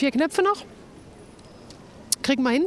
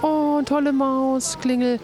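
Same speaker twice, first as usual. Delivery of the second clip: ordinary delivery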